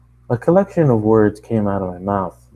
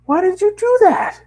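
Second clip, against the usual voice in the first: shocked high cry; 5 dB louder than usual. Acted. Pony shocked.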